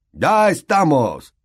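resigned sigh of a snubbed host; bitter. A narrator's reading. Nick hearing the hunt arrive, bitter. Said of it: "con cierta amargura".

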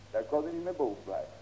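ordinary delivery

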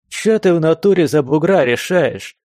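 высокий, громкий, неприятный писк; пищи дерзко и противно; писклявый голос. Acted. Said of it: "неприятно писклявым голосом". (писклявым голосом)

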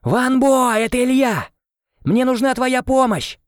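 searching, a high shouted call. A narrator's reading. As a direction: shout his name to find him. Calling for the lost boy.

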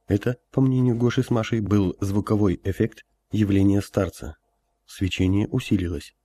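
ordinary delivery, nothing marked

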